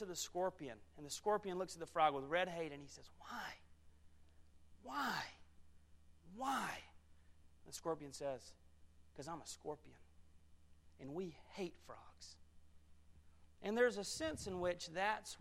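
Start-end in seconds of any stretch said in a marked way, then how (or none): 3.2-6.7 high, spaced-out plea, each word alone; stricken questioning. Acted. Stung frog asks the scorpion why, stricken and high. 9.2-12.4 cold confession. Acted. Scorpion answers the dying frog, quiet and cold. Scorpion coldly reveals its nature.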